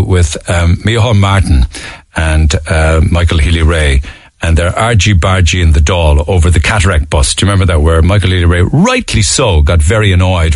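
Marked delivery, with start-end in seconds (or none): none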